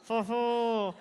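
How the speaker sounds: put-on jaw-locked French accent; mumbled French accent, jaw held still. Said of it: "it's hard to do a French accent when you don't move your jaw".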